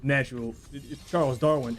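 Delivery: normal talking voice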